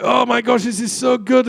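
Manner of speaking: high-pitched, gushing student enthusiasm; excited delight. Retold or imitated. Student recalling a lecture they loved, excited delight.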